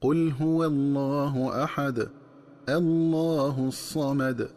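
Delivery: slow, stopping on أحد then a full heavy الله; recited with a pause. Shown as a demonstration. Teacher demonstrating the separated reading, slow recitation. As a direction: pause after أحد, start fresh with الله. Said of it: "هكذا".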